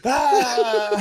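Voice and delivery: high laughing shriek; shriek of laughter. Retold or imitated. Losing it in class, high laughing shriek. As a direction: burst out in a squeal of laughter. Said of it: "I was like".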